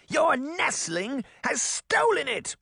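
high, slow witch screech; accusing witch. Acted. Witch accusing Granny's nestling of theft, screeching.

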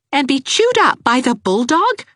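high, mocking retort; sarcastic young woman. A narrator's reading. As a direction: throw it back at him dryly.

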